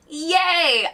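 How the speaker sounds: bright joyful yelp; excited cheer. Real, not acted. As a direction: cheer out loud with delight. Cheering that stream works.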